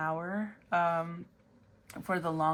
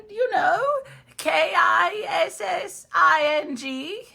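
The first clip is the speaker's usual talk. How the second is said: high, slow theatrical wizard chanting a spell; cackling sorcerer casting a spell, very high and loud; 6 semitones higher, 11 dB louder than usual. Acted. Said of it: "Mister Wizard?".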